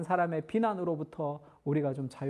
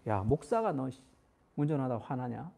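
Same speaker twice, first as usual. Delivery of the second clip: mock self-reproach; 7 semitones lower, 5 dB quieter than usual. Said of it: "막 화가 나면".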